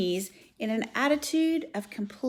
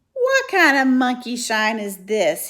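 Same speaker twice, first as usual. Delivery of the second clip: growl; 3 semitones higher, 9 dB louder than usual. Acted. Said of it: "he growled".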